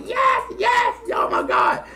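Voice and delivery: high joyful yells of yes, then a breathy oh my God; retold kid's victory whoop; excited shout. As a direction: cheer like a kid who finally gets the album. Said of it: "So I was excited".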